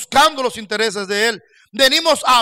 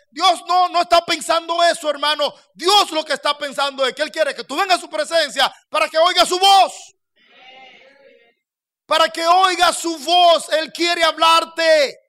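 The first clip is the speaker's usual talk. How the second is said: preaching climax; 5 semitones higher than usual. Acted. Pastor drives the point home to the congregation, shouting. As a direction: build and cry it out to the church.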